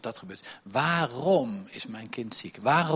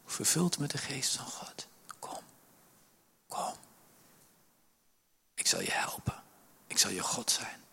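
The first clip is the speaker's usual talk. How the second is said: whispered, intimate promise; tender whisper; 7 dB quieter than usual. Acted. God whispering comfort to Adam. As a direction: breathe it softly like a secret.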